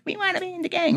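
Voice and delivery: put-on lightly squeaky high kid voice, soft; little kid voice. Young kids telling the gang they want in, lightly voiced eager kids.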